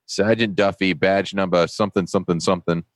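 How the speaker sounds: high-pitched South Boston cop giving instructions; South Boston accent. Retold or imitated. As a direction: imitate a South Boston police sergeant. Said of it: "South Boston, right down to his toes".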